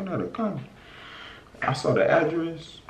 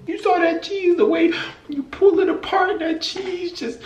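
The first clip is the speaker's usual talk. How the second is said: high, near-crying gush over the food; overcome with delight; 14 semitones higher than usual. Real, not acted. Reacting to a cheese pull, high tearful gush. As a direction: squeal as if the cheese pull moves you to tears.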